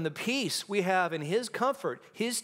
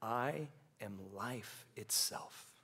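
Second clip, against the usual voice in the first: slow, soft, reverent; hushed reverent quote; soft quoted words; 7 semitones lower, 11 dB quieter than usual. Acted. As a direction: lay it down quietly. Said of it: "He says".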